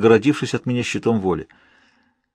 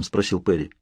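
ordinary delivery

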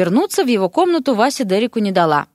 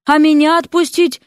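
high-pitched indignant question; affronted, voice pitched right up; 8 semitones higher, 4 dB louder than usual. A narrator's reading. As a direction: protest at being left behind. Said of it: "возмутился Дерек".

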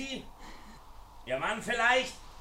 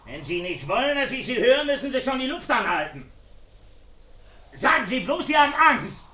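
loud mocking taunt; 3 semitones higher, 6 dB louder than usual. Villain mocking her fear.

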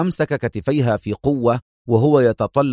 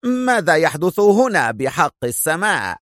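high, startled outcry; alarmed exclamation; 6 semitones higher than usual. A narrator's reading. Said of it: "وهو يهتف".

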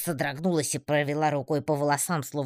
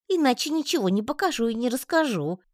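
упрямый паучок; 10 semitones higher than usual. A narrator's reading. Паучок ставит условие.

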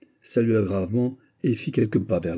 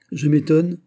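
higher, louder gleeful opener; 8 semitones higher, 6 dB louder than usual. A narrator's reading. Mazarin delighted to have remembered, gleefully needling Athos. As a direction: relish the malicious jab. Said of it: "tout joyeux d'avoir retrouvé la mémoire".

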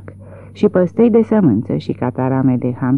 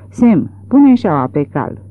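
plain reading voice

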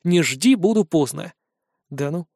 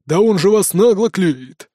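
indignant son; 3 dB louder, slower than usual.

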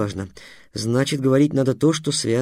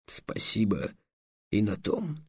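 grudging dragon; 2 semitones lower, 7 dB quieter, slower than usual. A narrator's reading. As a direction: mutter the thanks. (тихо и низко, нехотя)

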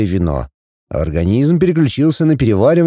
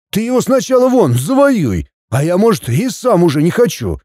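high-pitched, cheeky comeback; defiant retort; 6 semitones higher than usual. A narrator's reading. Son answering his father's inheritance threat, defiant. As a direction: argue back with lifted pitch.

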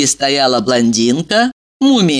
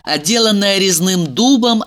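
plain reading voice

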